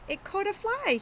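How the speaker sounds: Marty's voice. Acted. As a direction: squeak it out high like a proud little kid. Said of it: "he says".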